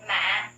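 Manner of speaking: MÃ dropped low and heavy; low falling tone. Shown as a demonstration. Teacher drills the sixth tone, MÃ low and falling. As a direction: press MÃ down to the bottom of the voice. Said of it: "low falling tone".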